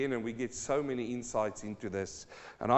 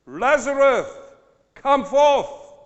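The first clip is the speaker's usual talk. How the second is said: higher, loud call to the dead man; loud cry; 12 semitones higher, 13 dB louder, slower than usual. Acted. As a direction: cry it out loud, summoning someone from the tomb. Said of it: "he cried with a loud voice".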